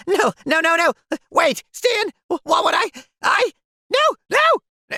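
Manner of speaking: rising, panicky no no; backing away in a panic; panicked protest. Acted. Panicked refusal, voice climbing.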